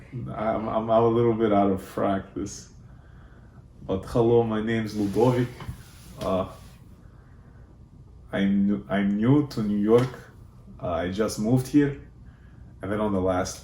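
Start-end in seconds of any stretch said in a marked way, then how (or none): 0.7-12.0 louder, put-on Russian accent. Shown as a demonstration. Demonstrating the accent used to mess with suspension school kids, Russian accent. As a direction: introduce yourself in a thick Russian accent. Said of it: "how good my Russian accent was".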